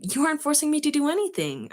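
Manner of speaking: high, insistent protest; high-pitched mild outburst; arguing. A narrator's reading. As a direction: push back, voice rising in objection. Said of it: "I argued".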